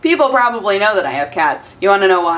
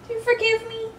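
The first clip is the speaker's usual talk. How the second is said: much higher and softer coo; 13 semitones higher, 8 dB quieter than usual. Real, not acted. Cooing to the cat.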